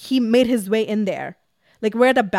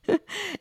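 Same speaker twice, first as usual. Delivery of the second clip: short squeaky giggle; high giggle; 10 semitones higher, 4 dB quieter than usual. Real, not acted. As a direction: giggle at your own joke. Laughing at her own line.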